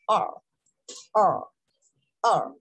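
letter "R" as English "ar", untrilled; tongue-back English R. Shown as a demonstration. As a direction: curl the tongue inward on "R". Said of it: "tu lengua lo metes hacia adentro".

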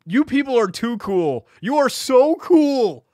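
high, loud mock gushing; over-the-top fake fan praise; mock admiration. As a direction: gush with put-on awe, stretching the words. Gushing mockingly over hacking team's manifesto.

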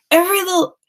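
sharp high indignant echo; outraged echo. Acted. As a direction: throw his words back at him. Annie repeats his words in outrage.

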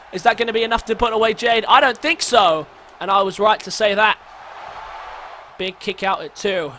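normal talking voice

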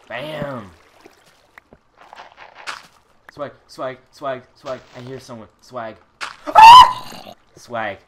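loud high victory yells; triumphant shouting. Real, not acted. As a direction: crow in triumph.

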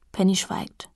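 ordinary delivery

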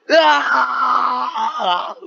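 high, loud yelling cry; scream. Acted. Friend on the call screams again in the game. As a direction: shriek out suddenly. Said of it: "He just screams".

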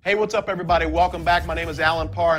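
ordinary talk

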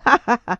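loud acted laugh, short and mocking; scornful laugh. A narrator's reading. Lover mocking the frightened countess, scornful laugh. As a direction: laugh right in her face. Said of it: "E mi rise sulla faccia".

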